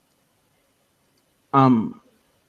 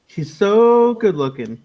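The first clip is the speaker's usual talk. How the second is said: higher-pitched, drawn-out mock admiration; mock gushing; 6 semitones higher, slower than usual. Mocking a colleague who thinks she's good-looking, mock gushing. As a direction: gush sarcastically, stretching it out.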